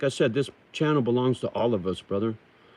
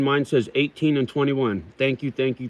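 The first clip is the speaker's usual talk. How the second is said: usual speaking voice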